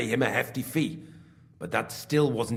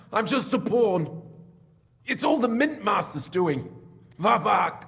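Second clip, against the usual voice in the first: high, urgent self-excuse; frantic pleading; 10 semitones higher than usual. Acted. Beaten pawn blaming the Mint Master, frantic plea. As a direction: plead innocence to save your skin. Beaten man shifts the blame.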